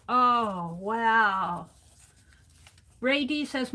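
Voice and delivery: normal talking voice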